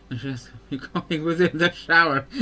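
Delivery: laughing through the words; giggling while talking. Real, not acted. Laughing at friend's embarrassing call.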